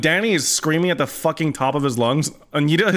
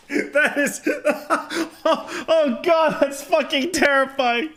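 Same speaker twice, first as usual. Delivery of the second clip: laughing; 8 semitones higher, much slower than usual. Real, not acted.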